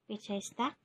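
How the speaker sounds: ordinary talk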